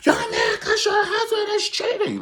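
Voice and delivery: mimicking the shrill accusation; shrill mock accusation. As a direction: screech it like you believe it. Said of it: "she was like".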